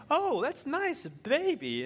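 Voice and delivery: high, vague sing-song reply; distracted husband. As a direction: say it nicely with the mind on the game. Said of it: "I'm like".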